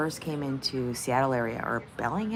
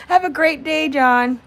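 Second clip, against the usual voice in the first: louder, higher friendly farewell; loud neighbourly Southern call; cheery call out; 9 semitones higher, 10 dB louder than usual. Pretend. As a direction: shout a goodbye across the yard. Calling out in cowboy scene.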